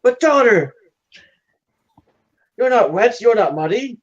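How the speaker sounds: high-pitched acted disbelief; hammed-up fatherly bafflement; puzzled father. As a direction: play a doubting dad poking at an excuse. Puzzled father questions daughter's story.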